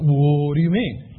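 low, slow puzzled voice of a recruit; puzzled prospect. Retold or imitated. Recruit to sponsor, voiced low and slow, puzzled. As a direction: drag out a confused question.

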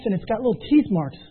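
usual speaking voice

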